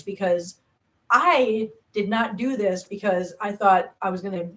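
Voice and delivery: usual speaking voice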